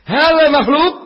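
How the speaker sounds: sharp high angry question. Retold or imitated. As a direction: demand it angrily as a question. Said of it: "intonasinya pertanyaan dan marah". (pertanyaan marah, nada tinggi)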